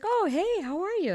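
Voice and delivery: high, breezy imitation of a colleague; cheery greeting. Surgeon picking up a colleague's phone call, cheery.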